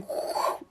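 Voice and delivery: soft, voiceless rush of air; imitated whoosh. Body flying back in meditation, imitated whoosh. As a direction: blow out the sound of flying backwards. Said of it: "my body was going".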